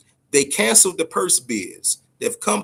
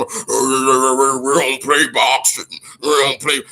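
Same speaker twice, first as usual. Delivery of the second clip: drawn-out, sobbing mockery of the complainers; mocking whiny complainer; much slower than usual. Mocking the boxing purists who whine, crybaby voice. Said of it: "'cause you got some".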